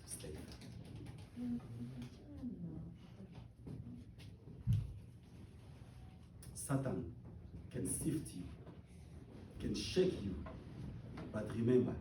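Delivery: soft, low prayerful voice; hushed prayer. Real, not acted. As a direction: draw the congregation into quiet prayer. Closing soft prayerful passage.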